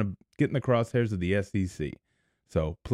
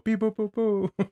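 robot beeps; 13 semitones higher, slower than usual. Pretend. Joke robot noises.

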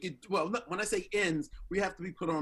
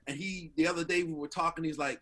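ordinary talk, nothing marked

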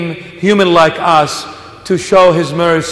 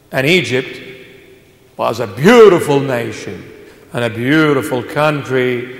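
normal talking voice

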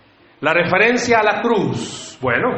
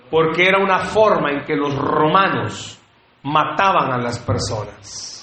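usual speaking voice

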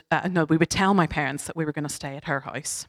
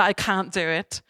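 ordinary talk

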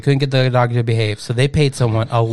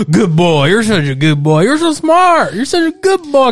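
praising the dog; 9 semitones higher, 3 dB louder than usual. Retold or imitated. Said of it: "he goes".

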